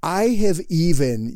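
ordinary delivery

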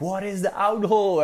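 high, baffled question; confused crewmate. Retold or imitated. Bob puzzled by the skipper's order, high confused voice. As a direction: ask it like a lost crewmate mid-storm. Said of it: "and Bob's like".

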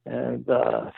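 usual speaking voice

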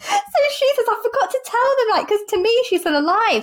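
barely getting words out for laughing. Real, not acted. Retelling funny story, laughing.

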